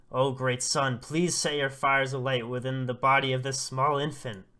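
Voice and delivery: loud, declaimed plea to a god; fervent invocation. Acted. Oba prays to the great sun over the infant, loud fervent invocation. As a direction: call out to the heavens with ceremony.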